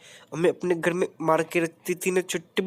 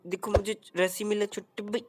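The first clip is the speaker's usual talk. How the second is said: normal talking voice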